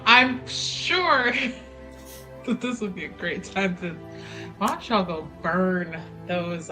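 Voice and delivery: ordinary delivery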